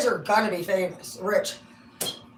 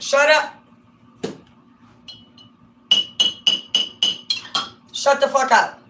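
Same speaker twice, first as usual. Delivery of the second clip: higher, louder, shouted shut-up; mock shout; 6 semitones higher, 7 dB louder than usual. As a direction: bark it like a put-down. Snapping at an imagined interrupter.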